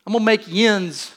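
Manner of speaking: Jesus recast as a Pittsburgh man, in a light Pittsburgh accent. Shown as a demonstration. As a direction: lean into the Pittsburgh "yins". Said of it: "a Pittsburgh thing".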